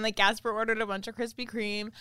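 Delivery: usual speaking voice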